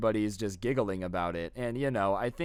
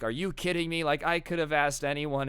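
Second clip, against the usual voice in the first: ordinary delivery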